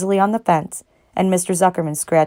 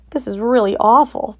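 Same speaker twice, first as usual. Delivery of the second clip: dismayed; 5 semitones higher than usual. A narrator's reading. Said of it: "thought Wilbur".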